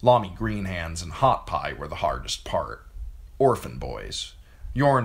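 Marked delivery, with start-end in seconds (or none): none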